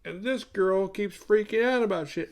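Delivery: high, drawn-out complaining dude voice. Retold or imitated. Jackass friend complains about the freak-out, put-on dude voice. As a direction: imitate a griping party bro. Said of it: "And T J's like".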